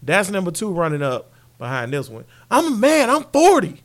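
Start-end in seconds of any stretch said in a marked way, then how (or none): 2.5-3.8 shouted, much higher coach rant; mock furious press-conference shout; imitating Mike Gundy. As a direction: yell it like an offended coach. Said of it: "Mike Gundy, bro".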